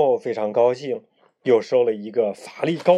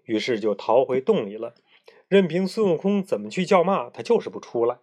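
plain reading voice

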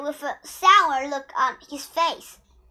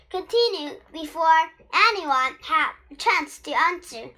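ordinary delivery, nothing marked

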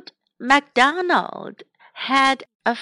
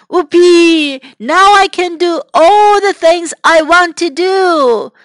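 loud and higher, delighted whoop; gleeful cheer; 5 semitones higher, 6 dB louder than usual. Acted. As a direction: cheer like a freed old man. Old MacDonald freed from farm.

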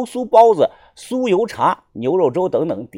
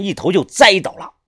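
ordinary delivery

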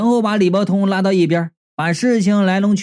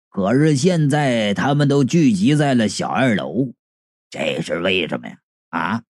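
measured elder voice sinking low; old martial uncle, solemn deduction; 6 semitones lower than usual. Acted. Uncle links the recent ghosts for his disciple, grave. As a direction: lay out the mystery, ending in a low probing question.

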